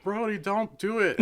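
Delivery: high, laughing imitation of the worried mom; laughing mock plea. Mom begging her son not to throw, high and laughing. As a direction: squeal it through laughter like the nervous mom. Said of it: "his mom's like".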